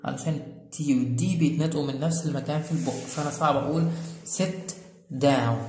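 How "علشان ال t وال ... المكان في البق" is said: ordinary talk, nothing marked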